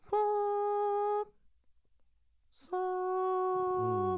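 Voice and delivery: long, high held note; mock trance tone. Comic fakes channelling a spirit, long trance tone. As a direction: hold one high, eerie note endlessly.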